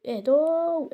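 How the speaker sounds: slow, high, stretched cry; pleading with attackers, high and drawn out; high drawn-out plea. Real, not acted. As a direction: call out high, dragging the last vowel. Calling on attackers to calm down.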